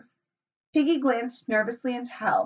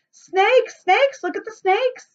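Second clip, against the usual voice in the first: high, loud panicked shrieks; screaming; 13 semitones higher, 5 dB louder, slower than usual. A narrator's reading. Little boys screaming at the creepers in terror. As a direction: shriek out the alarm. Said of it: "The little boys screamed".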